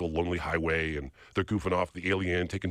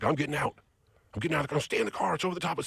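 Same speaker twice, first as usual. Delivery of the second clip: imitating the younger kid's frantic cry, higher; urgent, pitched-up retold panic; scared and frantic; 7 semitones higher, faster than usual. Younger kid telling friend he's leaving the car, frantic high voice. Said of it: "they're very scared".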